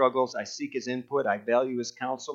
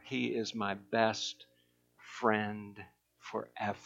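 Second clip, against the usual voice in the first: softer, slower, sincere declaration; hushed devotion; 6 semitones lower, 12 dB quieter, much slower than usual. Real, not acted. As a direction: say it softly, from the heart.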